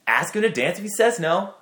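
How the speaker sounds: high-pitched pep talk, acted out; replayed insistent encouragement, high and pressing; retold urging. Retells urging friend to ask.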